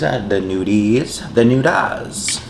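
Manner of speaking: "nudies, nudas", drawn out and rising; slow, goofy sing-song "nudas"; playful silly pronunciation. Shown as a demonstration. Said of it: "The noodles".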